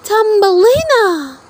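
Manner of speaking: high, tender naming; woman. A narrator's reading. Woman naming her tiny girl Thumbelina, tender and high.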